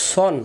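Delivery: সন as a full "sawn"; rounded Sad sound. Shown as a demonstration. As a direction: round the vowel of সন, no "aa". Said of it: "সান পড়লে সিনে চলে যাবে". (সন, গোল মুখে মোটা করে)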